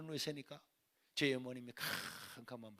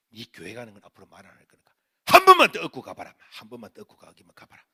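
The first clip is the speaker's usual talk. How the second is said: mother's threat; 5 semitones lower, 20 dB louder than usual. Retold or imitated. Mother threatening son over church.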